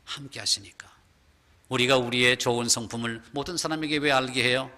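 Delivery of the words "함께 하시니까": 함께 하시니까 whispered, unvoiced and soft. Shown as a demonstration. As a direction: breathe 함께 하시니까 as a hushed echo. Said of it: "whispered repeat". (함께 하시니까를 속삭이듯)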